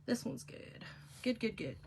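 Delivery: soft unvoiced whisper to herself; hushed self-talk over the craft table; whispered aside. Real, not acted. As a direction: breathe the words, barely there. Quiet aside while crafting.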